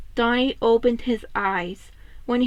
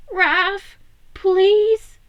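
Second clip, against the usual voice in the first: high, louder urgent please; high pleading; 8 semitones higher, 6 dB louder than usual. Acted. Leo pleading with Raph to pull over, high and urgent.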